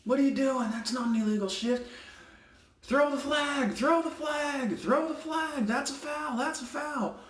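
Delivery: high, nagging coach impression; a coach pestering the ref, hammed up high; mock whiny coach. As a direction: whine it like an outraged coach. Mocking coach demanding a flag.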